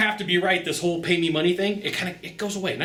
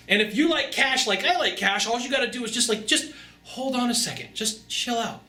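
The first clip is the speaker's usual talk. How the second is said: much higher, easy surfer dude voice; laid-back Hawaiian dude; 8 semitones higher than usual. Retold or imitated. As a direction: soothe him like a chill island guy. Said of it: "And I'm like".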